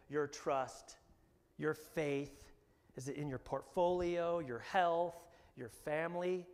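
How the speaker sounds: ordinary talk, nothing marked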